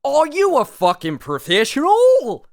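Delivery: slow, high, loud mock rant with a wandering accent; hammed-up shouted outrage, stretching the last word. Podcaster showing how the actor's accent slips mid-rant, high hammed-up shout. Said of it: "go in and out of his accent".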